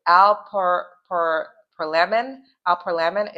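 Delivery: "alprazolam" sounded out slowly, with false starts; slow, halting try at "alprazolam"; careful attempted pronunciation. Real, not acted. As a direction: feel your way through "alprazolam" piece by piece. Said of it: "forgive me if I'm saying it wrong".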